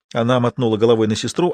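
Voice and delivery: ordinary delivery, nothing marked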